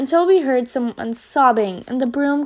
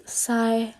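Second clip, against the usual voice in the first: usual speaking voice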